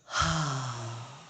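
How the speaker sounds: long open "haa" sigh; audible breath release. Shown as a demonstration. Guide showing meditators how to let go, sighing exhale. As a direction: breathe out fully and audibly after holding. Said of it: "and then s".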